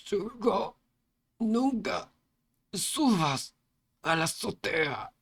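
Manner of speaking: high, strained dying plea; last words. A narrator's reading. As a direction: warn him with your last breath. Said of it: "en sus últimas palabras". (últimas palabras, súplica aguda y quebrada)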